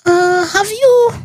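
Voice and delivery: high, halting, unsure questions; imitating a nervous technician who won't say; hesitant sonographer. Sonographer to pregnant patient, mock hesitant high voice. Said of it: "this guy has question".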